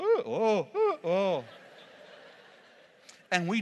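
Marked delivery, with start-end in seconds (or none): 0.0-1.4 high, loud, half-laughing fright; frightened oh oh. Retold or imitated. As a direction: stammer in mock terror. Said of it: "I go".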